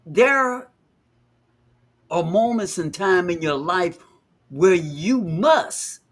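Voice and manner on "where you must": must drawn out slow and weighted; slow, pressing emphasis on must; stress on must. Real, not acted. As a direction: lean hard on the word must. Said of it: "I stress that word must".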